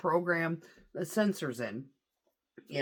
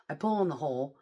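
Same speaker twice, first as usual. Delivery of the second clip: ordinary talk, nothing marked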